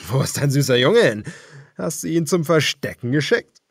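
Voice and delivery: leering villain taunt; taunting cannibal. A narrator's reading. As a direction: sneer at a cornered man. Cannibal leader taunting Daniel.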